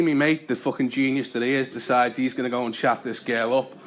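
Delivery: ordinary delivery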